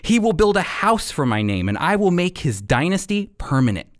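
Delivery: ordinary talk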